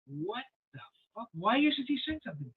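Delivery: mock exasperation, kept under the voice; hushed disbelief. Silent disbelief at drunk intruder.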